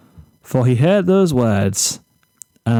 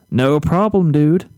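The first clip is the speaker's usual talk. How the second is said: breezy, slightly higher laid-back voice; carefree Zephyr; 4 semitones higher than usual. Acted. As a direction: toss it off like a clueless surfer kid.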